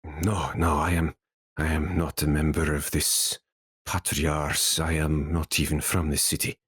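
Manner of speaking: low-pitched, weary wounded sage; injured scholar answering in a low voice. Acted. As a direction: drop your voice low as a rescued prisoner.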